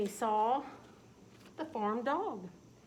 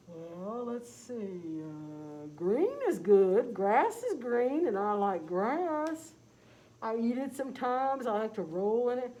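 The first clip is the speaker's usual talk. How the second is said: loud slow dog voice; 4 dB louder, slower than usual. Acted. Farm dog suggesting green to Tom, slow loud dog voice. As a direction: drawl it out like a lazy dog.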